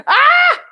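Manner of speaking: sudden high yelp of annoyance; a sharp frustrated cry. Real, not acted. Frustrated at stream timing out.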